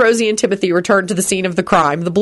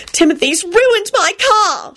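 very high, slow wailing complaint; shouting; 14 semitones higher, slower than usual. A narrator's reading. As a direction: wail it down the phone. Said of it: "she shouted".

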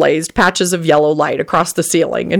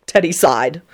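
usual speaking voice

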